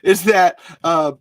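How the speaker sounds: hammed-up impression of an excited friend; Chris. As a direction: build to a drawn-out, booming big reveal. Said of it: "I'm gonna try to do Chris now".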